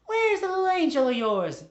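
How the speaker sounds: high, loud caricature of a nosy wife; Missus Wiggles, pushy. Acted. Missus Wiggles asks after the little angel, pushy squawk. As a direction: squawk it out, prying and excited.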